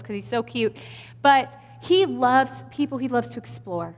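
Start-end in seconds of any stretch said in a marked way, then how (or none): 3.0-3.9 "explore" set apart as the key word, dropping softer; emphasis on EXPLORE. Real, not acted. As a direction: land meaningfully on "explore". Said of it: "Heavy emphasis on the word explore".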